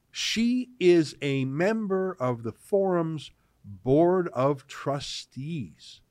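very slow, deliberate reading aloud; drawn out slowly for emphasis; emphatic read-out. Real, not acted. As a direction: spell it out word by word. Reads key line out with emphasis.

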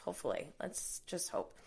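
whispered, trailing off; whispering. Real, not acted. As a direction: breathe it out as a hope. Trailing hope.

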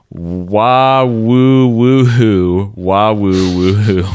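high, drawn-out whooping yell; rebel yell imitation. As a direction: whoop it slow and high like a war cry. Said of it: "Confederate veterans performing the yell as a high-pitched".

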